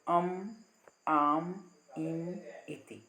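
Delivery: अम आम इम each said as a short syllable ending on a nasal m; sounding out anusvara. Shown as a demonstration. Teacher shows students how anusvara is sounded. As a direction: model the vowel plus m sound cleanly. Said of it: "तो फिर बोलो अम, आम, इम".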